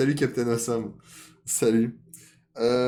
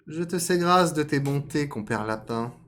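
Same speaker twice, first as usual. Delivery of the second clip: raised, loud fox voice; fox accepting; 6 semitones higher, 5 dB louder than usual. Acted. Fox thanking rabbit for his invitation, raised voice. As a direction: give formal thanks in a high character voice. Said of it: "dit le renard".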